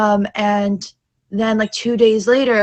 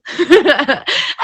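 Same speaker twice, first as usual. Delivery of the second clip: a short higher laugh after the line; high burst of laughter; 6 semitones higher, 4 dB louder than usual. Real, not acted. Laughs after retold retort.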